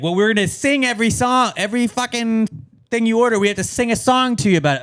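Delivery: imitating a gimmicky waiter; restaurant server spiel. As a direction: pitch it up like an eager server. Imitating server announcing singing gimmick.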